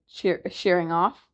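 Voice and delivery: plain reading voice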